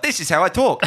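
high, loud, hammed-up imitation of a lazy actor; loud mocking impression of an actor. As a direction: play a smug actor shrugging off the accent. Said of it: "Aaron Taylor Johnson's like".

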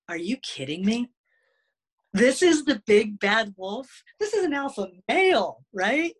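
high-pitched mock disbelief; incredulous questioning, climbing high; retold incredulity. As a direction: re-enact baffled, amused astonishment. Acts out disbelief at timid wolf.

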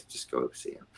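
low, hushed, dismissive aside; hushed order. Retold or imitated. Lieutenant colonel to captain, retold hushed order. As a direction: send him off under your breath.

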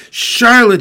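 high, loud wail of a name; anguished moan. A narrator's reading. Wilbur to his dying friend, moaning loudly. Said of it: "he moaned".

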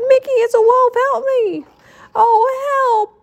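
high-pitched panicked cries; crying out. A narrator's reading. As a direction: cry for help, chased by a wolf. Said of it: "cried Donald".

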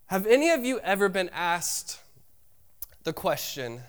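ordinary talk

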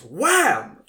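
high, very loud burst; shouted exclamation. Retold or imitated. Telling co-host how someone yelled, shouted exclamation. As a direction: blurt it out as a sudden bang. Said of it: "he just goes".

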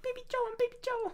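very high, soft squeaky voice; mock baby voice. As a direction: play a tiny demanding baby. Same talking baby bit.

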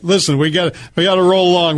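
ordinary delivery

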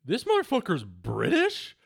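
high-pitched, incredulous question; astonished, voice jumping up high. Retold or imitated. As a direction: blurt it out in disbelief at the news. Acting out astonished reaction to interview.

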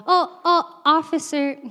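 high innocent voice. Retold or imitated. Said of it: "my voice goes up a couple octaves".

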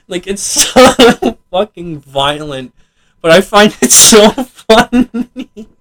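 slow words squeezed through laughter; real giggles stretching the words; amused disbelief. Real, not acted. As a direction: crack up as you say it. Laughing at violent scene.

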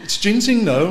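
high, loud mock mum voice defending her tonic; imitating his mum. Mum insists her warm tonic is fine, imitated high and loud. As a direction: put on mum's indignant squeal. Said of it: "she was gonna scream about this".

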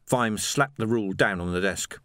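ordinary delivery, nothing marked

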